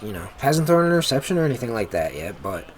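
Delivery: ordinary talk, nothing marked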